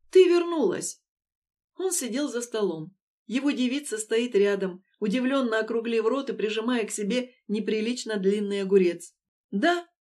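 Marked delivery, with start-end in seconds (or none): 0.1-1.0 high, loud astonished exclamation; amazed son greeting his mother; surprised. A narrator's reading. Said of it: "удивился Коля". 9.5-9.9 higher-pitched, contented yes; pleased confirmation; satisfied. A narrator's reading. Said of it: "удовлетворённо ответила Люба".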